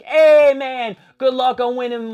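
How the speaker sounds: loud, high imitated well-wishing; hearty shouted greeting. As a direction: call out to a buddy, playing it big. Voicing excited greeting by wrestler.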